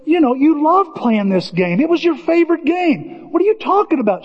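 high, incredulous urging; imitated insistence. Friend reminding Peter it was his favorite game, insistent. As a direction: press the point with rising pitch.

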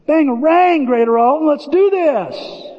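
high, drawn-out battle cry; rallying cry. Real, not acted. Pastor rallying the church to dream, high hollered cry. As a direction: holler it to fire up the whole room.

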